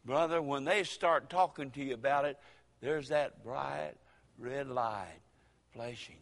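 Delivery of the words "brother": ordinary talk, nothing marked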